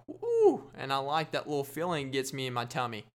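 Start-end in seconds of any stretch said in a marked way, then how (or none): 0.1-0.7 high, loud, squealed "ooh"; squirmy "ooh". Shown as a demonstration. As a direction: squeal as if delightfully grossed out. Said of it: "It makes me go".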